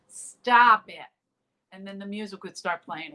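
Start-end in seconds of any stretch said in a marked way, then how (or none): 0.1-1.1 loud clipped command to the ghost; retold sharp scold. Retelling Mitzi ordering the ghost to quit, loud sharp scold. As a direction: bark it at an invisible troublemaker.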